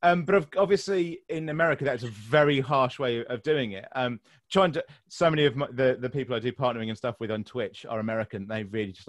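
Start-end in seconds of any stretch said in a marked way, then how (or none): none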